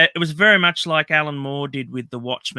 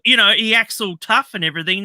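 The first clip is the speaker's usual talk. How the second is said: normal talking voice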